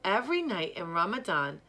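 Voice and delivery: ordinary delivery, nothing marked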